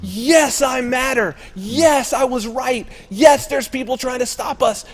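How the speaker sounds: mock triumphant protester. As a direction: crow like you've been proven right. Voicing validated protester.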